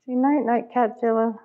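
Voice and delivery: squeaky bedtime coo; high pet voice. Real, not acted. Talking to cat at bedtime.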